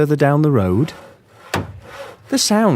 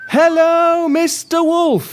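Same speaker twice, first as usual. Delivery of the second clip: much higher, louder shouted call; shouting louder; 13 semitones higher, 3 dB louder, slower than usual. Shown as a demonstration. Presenter and children shouting to the wolf, even louder. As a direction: really holler it across to him. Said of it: "We'll have to shout even louder".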